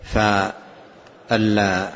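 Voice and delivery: usual speaking voice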